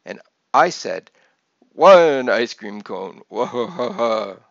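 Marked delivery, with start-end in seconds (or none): 1.7-4.4 put-on child's copy of a slow Transylvanian count; hammy, drawn-out Transylvanian counting; best Transylvanian accent. A narrator's reading. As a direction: imitate a Transylvanian count, loud then laughing. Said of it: "doing my best Transylvanian accent".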